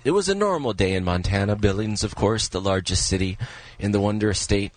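low, measured true-crime narrator; deep-pitched, deliberate storytelling impression; Keith Morrison. Shown as a demonstration. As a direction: drop low into a newsmagazine storyteller's delivery. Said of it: "that's my Keith Morrison impression".

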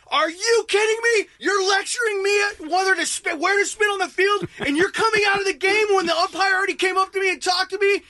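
high-pitched ranting yell; indignant yelling. Retold or imitated. Player yelling at the husband in his face, indignant rant. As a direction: reenact an outraged tirade, pitch held high. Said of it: "yelling at him".

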